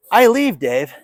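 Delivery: laughing. Real, not acted. Laughs while speaking.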